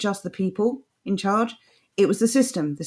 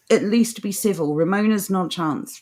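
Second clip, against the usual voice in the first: usual speaking voice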